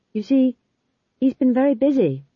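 timid Magrat-style defence; meek young witch. A narrator's reading. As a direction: make shy excuses to your own feet. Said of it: "said Magrat to her feet".

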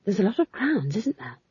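change the subject nervously; hurried subject change. A narrator's reading. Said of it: "added hurriedly".

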